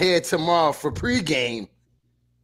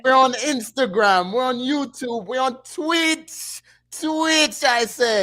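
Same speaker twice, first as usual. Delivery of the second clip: put-on high Jamaican accent, slowing on Twitch; 9 semitones higher, slower than usual. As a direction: lift it up in a Jamaican lilt. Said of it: "he gonna do this whole part right here in Jamaican".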